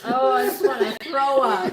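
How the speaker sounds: loud outburst through laughter; laughing disgust. Real, not acted. Reacting to gross talk with friends, loud laughing disgust. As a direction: blurt it out loudly while cracking up.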